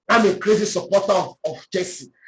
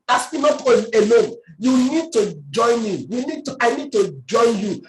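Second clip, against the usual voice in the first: ordinary delivery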